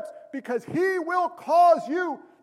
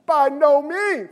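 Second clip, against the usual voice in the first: BY NO MEANS slow and raised; strongest emphatic; 3 semitones higher, 4 dB louder, slower than usual. Acted. Preacher reading Paul's answer on the law, slow emphatic BY NO MEANS. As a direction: stretch out "no means" with full force. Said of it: "That's the strongest emphatic in the Greek".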